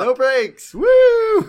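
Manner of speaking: loud, high whooping shout; hammed-up rallying cry; mock gung-ho cheer. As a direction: whoop it up like an over-keen team. Acting gung-ho brainstorming staff.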